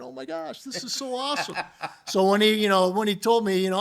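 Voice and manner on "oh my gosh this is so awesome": much higher, soft, giggling delight; thrilled thought. Retold or imitated. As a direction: squeal inwardly with joy. Thrilled inner thought retold.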